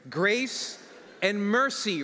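very slow, drawn out; slow emphasis. Real, not acted. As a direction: stretch out grace and mercy. Slowed for emphasis.

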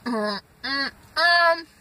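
chewing pizza; talking with food in mouth; mouth full. Real, not acted. Seen 1: Bites pizza at -2; food in open mouth through labelled words.

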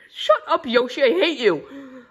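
irritated outburst; annoyed snap. Acted. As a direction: snap at the brat. Guardian snaps at whining kid.

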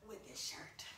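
soft unvoiced whisper. Real, not acted. About to fall in love with a shirt, whisper. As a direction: whisper it with anticipation.

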